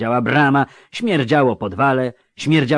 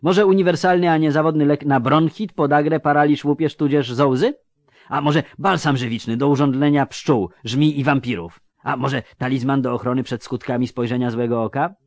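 higher-pitched salesman reeling off wares; eager peddler; 4 semitones higher than usual. Acted. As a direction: pitch the remedies eagerly, item after item. (przekupień zachwalający towar, wyższym głosem)

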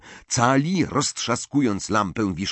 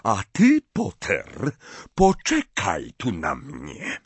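cold fury; slower than usual. A narrator's reading. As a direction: bite off "Potter" and go icy. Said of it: "głosem pełnym zimnej furii".

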